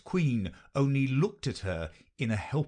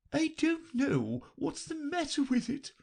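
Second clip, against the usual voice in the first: high, mournful lament; a mild droop of sorrow in the Queen's voice; melancholy. A narrator's reading. The Queen fretting over her shawl, melancholy. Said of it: "in a melancholy voice".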